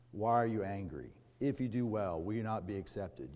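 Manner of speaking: soft, low and intimate; hushed personal question from God; quiet searching question. Acted. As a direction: ask it gently to each listener. God asking the listener quietly.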